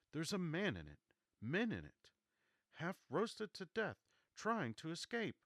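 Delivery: high, loud, urgent cry; excited shout. Acted. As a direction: shout it out in alarm. Ogilvy cries out in horror.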